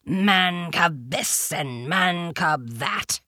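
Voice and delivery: slow, drawn-out tiger rumble; slow growled grumble; rumbling tiger. A narrator's reading. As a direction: rumble it with contempt. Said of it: "he rumbled".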